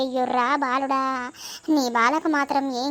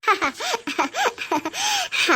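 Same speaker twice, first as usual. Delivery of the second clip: divine laugh; 7 semitones higher than usual. Acted. Goddess speaks through possessed woman.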